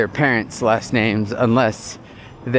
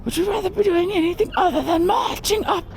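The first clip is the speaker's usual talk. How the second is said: high, strained, squeezed-down drill sergeant yell; holding back a shout. Retold or imitated. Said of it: "my terrible impersonation that I'm trying not to yell too loudly".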